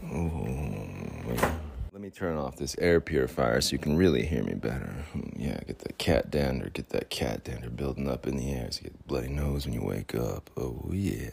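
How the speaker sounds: low, soft smooth-talker voice; bling character. Acted. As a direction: purr it deep and suave, as mock seduction. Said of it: "I turn into this character who's Bling".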